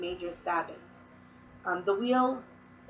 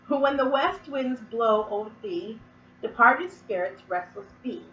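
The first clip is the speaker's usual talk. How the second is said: slow and louder, every word weighed; solemn verse recital; 6 dB louder, slower than usual. Acted. Witch reciting the autumn verse to viewers, slow incantation. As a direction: intone it like an incantation.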